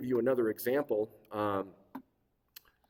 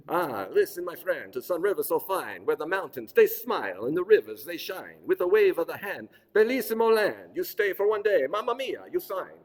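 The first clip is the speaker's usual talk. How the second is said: hammed-up Italian accent, higher and louder; 5 semitones higher, 6 dB louder than usual. Presenter performs AI limerick to audience in hammed-up Italian accent. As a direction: ham up an Italian accent with big swoops. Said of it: "but with an Italian accent".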